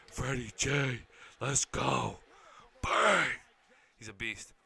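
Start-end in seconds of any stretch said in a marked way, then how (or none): 0.1-3.3 loud, rising hype shout; excited cheer. Real, not acted. Fan cheering Freddie J's run, excited shouting. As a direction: punch it out like a fan at a big play. Cheering a running back's play.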